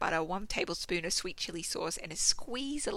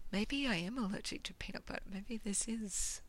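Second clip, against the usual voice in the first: whispering; 8 dB quieter than usual. Acted. Worried aside.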